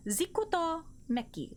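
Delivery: Zikuto Meki sounded out part by part; careful name pronunciation. Shown as a demonstration. Streamer checks a new follower's name, careful pronunciation of Zikuto Meki. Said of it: "That's how you pronounce it, right?".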